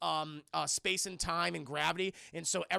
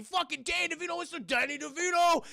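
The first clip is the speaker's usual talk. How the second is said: excited shout, pitched up; rowdy acclaim; 10 semitones higher than usual. Retold or imitated. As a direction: holler the name like a hype man. Acting out hailing Dipper as DeVito.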